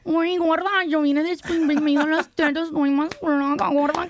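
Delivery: high, hammed-up Colombian accent. Shown as a demonstration. Friend showing off for co-hosts who demand it, Colombian accent. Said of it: "my second best accent".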